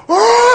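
sharp high cry of pain; loud high yelp of pain. Retold or imitated. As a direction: yelp like you just got hit in the nose. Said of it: "next thing I hear him go".

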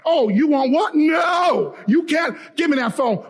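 high, agitated acted protest; worked-up, high-pitched refusal; indignant husband. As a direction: cut in on the call, outraged. Acted husband taking the phone.

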